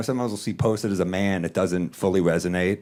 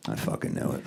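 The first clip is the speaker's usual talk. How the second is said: 1 muttered knowing; 8 dB quieter than usual. Retold or imitated. Muttered at reckless driver.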